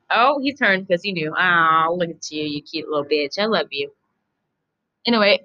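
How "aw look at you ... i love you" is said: talking sweetly to her cat; cooing at cat. Real, not acted. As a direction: coo at the cat like a pet.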